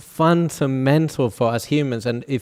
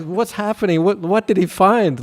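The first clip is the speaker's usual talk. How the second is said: high, lightly voiced puzzled asking; mock-curious high voices; puzzled angels; 8 semitones higher than usual. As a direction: sound like curious heavenly attendants. Heaven's staff wondering aloud.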